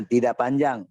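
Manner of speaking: normal talking voice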